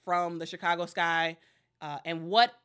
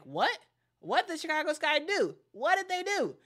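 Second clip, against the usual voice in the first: rising, much higher, incredulous what; shocked disbelief; 9 semitones higher than usual. Retold or imitated. Fans react to the news with high incredulous disbelief. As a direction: voice the fans' shock, going up and up.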